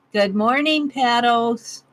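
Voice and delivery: usual speaking voice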